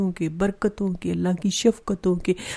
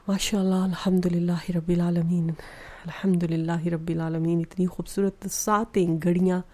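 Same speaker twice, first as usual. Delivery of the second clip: ordinary talk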